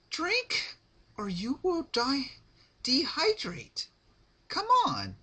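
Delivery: high, sing-song sweet menace; bright cheery threat, voiced high; Pinkie Pie voice. Narrator gives Pinkie a high sweet creepy voice.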